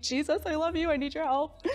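little cry for help; mock desperate plea. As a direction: plead for help, playfully overdone. Acted plea to Jesus.

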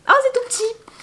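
high-pitched delighted exclamation; delighted surprise. Real, not acted. Vlogger finding something tiny in her calendar, delighted surprise. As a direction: squeal at how small it is.

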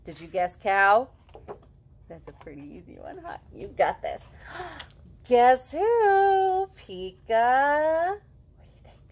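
playful guessing-game read-aloud; reading to children. A narrator's reading. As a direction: ask each guess who with a bright high lift. Reading peekaboo book to children.

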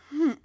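a short, loud, scornful humph; indignant little snort; grumpy humph. A narrator's reading. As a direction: huff it out like a sulky child. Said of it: "said the littlest cricket".

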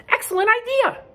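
very high and loud; exclaiming. Acted. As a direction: cheer it like a delighted duck. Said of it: "exclaimed Duck".

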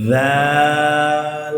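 দাল as a light "dhaaal" with "th" as in "this"; thin, stretched. Shown as a demonstration. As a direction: hold the thin "aa" of দাল long and level. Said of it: "দাল পাতলা হবে".

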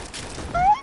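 high squeak of disbelief; shocked squeak. Real, not acted. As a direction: yelp it in shock. Sudden death in game.

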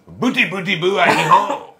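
put-on French accent. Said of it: "Like Pepe Le Pew".

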